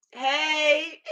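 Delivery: high, drawn-out playful hey; acted greeting. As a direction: sing out a long high greeting. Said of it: "I'm like".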